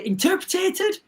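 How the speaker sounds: interpreted? lifted high at the end as a puzzled query; unsure high rise on interpreted?; doubtful rising question. Shown as a demonstration. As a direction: ask whether interpreted? is even a word. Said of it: "Is that a word?".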